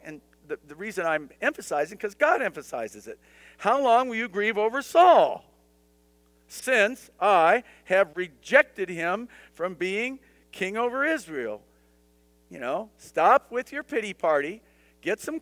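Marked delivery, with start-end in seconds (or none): 3.6-11.6 louder and higher, slow on "since I have rejected him"; stern rebuke. Retold or imitated. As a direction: demand an answer, weary of his grieving. God's rebuke, read with force.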